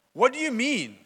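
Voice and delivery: high, startled question; exclaiming in surprise. Retold or imitated. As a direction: blurt it out in disbelief. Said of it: "exclamation, exclamation".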